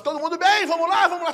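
high, loud, upbeat class call; instrutor animado. Retold or imitated. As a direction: call out brightly to the whole class like a fitness instructor. Imita o instrutor animado chamando a turma. (instrutor empolgado chamando a turma em voz alta)

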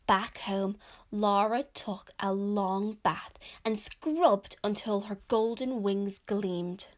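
loud, clear storybook reading; children's story narration. A narrator's reading. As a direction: read it out to young children, projected. Reads picture book aloud.

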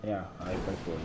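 ordinary talk

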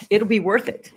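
laughing. Real, not acted. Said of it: "Did you hear me?".

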